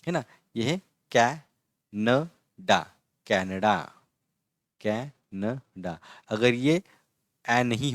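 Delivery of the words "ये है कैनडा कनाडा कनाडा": कनाडा drawn out slowly, first vowel like the a in cat; teacher models कनाडा; careful pronunciation. Shown as a demonstration. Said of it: "जैसे ये देखो".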